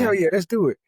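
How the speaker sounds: high, eager agreement; eager yes. Retold or imitated. Couple at the timeshare jumping at free tickets, high and eager. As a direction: jump at the free offer. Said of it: "And we was like".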